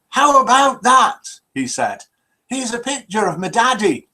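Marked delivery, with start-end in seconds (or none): 0.1-1.4 slow, high, loud hillbilly exclamation; drawn-out mock hillbilly wonder; hillbilly accent. Joke teller as old hillbilly seeing a mirror, hillbilly accent. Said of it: "That's my best hillbilly accent". 2.5-4.1 higher-pitched mock hillbilly; hillbilly accent. Old hillbilly mistaking his reflection for daddy, hillbilly accent. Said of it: "That's my best hillbilly accent".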